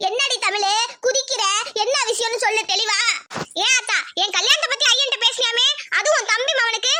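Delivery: normal talking voice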